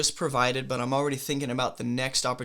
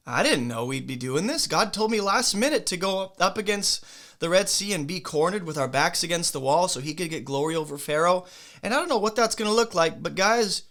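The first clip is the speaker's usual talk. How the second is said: higher, louder, hurried protest; worked-up retelling of a cornered leader; flustered leader; 4 semitones higher, 3 dB louder than usual. Acted. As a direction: plead your case to an uneasy crowd. Acts out Moses addressing the cornered people.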